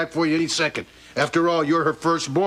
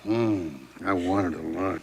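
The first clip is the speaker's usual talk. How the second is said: deep murmur; 11 semitones lower, 6 dB quieter than usual. Acted.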